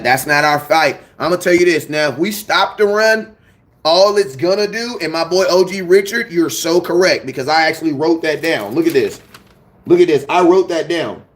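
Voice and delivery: ordinary talk, nothing marked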